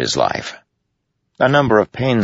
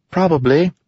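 high, airy and teasing; light teasing; 14 semitones higher, 3 dB louder than usual. A narrator's reading. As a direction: toss it off lightly like a sprite. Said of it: "Syl said lightly".

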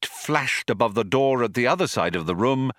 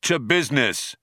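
somewhat higher, hearty toast; 5 semitones higher than usual. A narrator's reading. Two friends clinking glasses, toasting. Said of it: "clinked their glasses together".

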